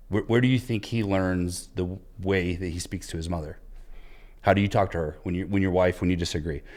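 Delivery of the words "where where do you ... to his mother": normal talking voice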